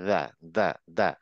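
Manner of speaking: দা repeated, tongue at the teeth root; teacher drills দা again and again; dental da. Shown as a demonstration. Teacher drills class on dental দা. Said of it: "কিন্তু এটা হচ্ছে দা".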